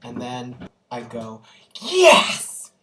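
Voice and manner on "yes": much louder, much higher triumphant yell; loud yes. Retold or imitated. In class when the slap lands, triumphant loud yell. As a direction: shout yes like the villain finally got slapped. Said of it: "Extremely loudly".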